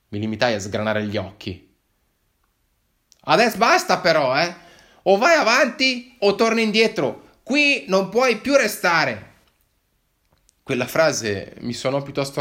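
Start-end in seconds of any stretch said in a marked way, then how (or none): 3.2-9.3 high, loud, sharp voice of a fed-up barista woman; scolding barista woman. Acted. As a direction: barista losing patience, shouting at a pushy customer. Barista scolds narrator at counter.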